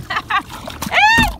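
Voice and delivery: a delighted squeal of laughter; high happy laugh. Real, not acted. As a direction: burst into a high, loud laugh. Gleeful over catching fish. (risada alta e aguda de alegria)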